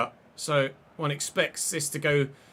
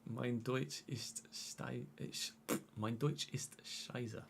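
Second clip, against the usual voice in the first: whispered; 3 semitones lower, 14 dB quieter, much slower than usual. Pretend.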